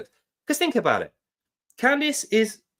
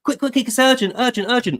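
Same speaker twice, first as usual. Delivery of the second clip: higher, louder, hammed-up panic; mocking frantic voice, pitched up and loud; mock urgency; 8 semitones higher, 5 dB louder than usual. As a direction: parody a breathless alarm call. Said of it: "what she's effectively saying is this".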